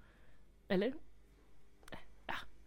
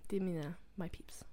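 whispered aside; 9 dB quieter than usual. Real, not acted. Viskar om högstadieelever.

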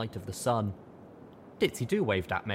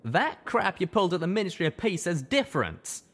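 firm, louder and higher; asserting; 9 semitones higher, 4 dB louder than usual. A narrator's reading. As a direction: push back with conviction. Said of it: "Calamity asserted".

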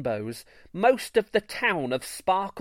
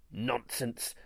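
low, soft dismissive mutter; 4 semitones lower, 12 dB quieter than usual. A narrator's reading. Greymane scoffing at the rumour, muttering. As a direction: grumble it under your breath. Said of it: "muttered Greymane".

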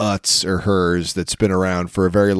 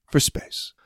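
whispered repeat; 5 dB quieter than usual. Acted.

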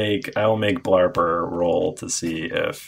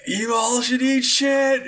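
high villain taunt. Acted. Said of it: "he goes".

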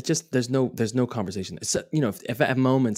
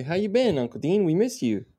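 put-on child's voice; 9 semitones higher than usual. Voicing lines for the child.

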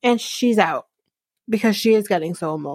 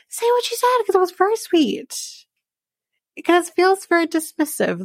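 sarcastic disbelief; 9 semitones higher than usual. Real, not acted. Recapper scoffing at the son's translation, sarcastic and high. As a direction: pitch jumps much higher in incredulous mockery.

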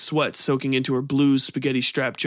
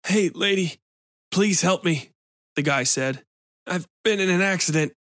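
pleading injured man; 5 semitones higher than usual. A narrator's reading.

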